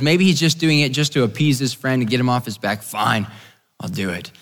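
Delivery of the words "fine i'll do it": low, trailing grudging consent; reluctant agreement. Acted. Nathanael appeasing his pushy friend, reluctant. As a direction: give in to get him off your back. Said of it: "reluctantly, okay, you know, he hesitantly goes".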